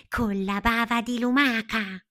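high, loud little caterpillar voice; eager answer. A narrator's reading. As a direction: proclaim it as a brilliant idea. Palmira proudly answers with her idea.

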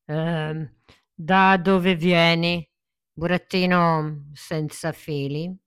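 loud, slow big-man voice; gruff puppet master. Acted. Puppet master to the puppet, booming and slow. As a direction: boom it out as a stern giant showman.

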